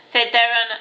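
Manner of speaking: usual speaking voice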